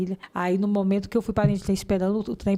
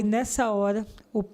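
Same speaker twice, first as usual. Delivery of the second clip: ordinary talk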